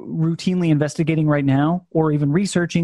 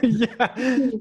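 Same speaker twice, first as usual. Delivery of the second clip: ordinary delivery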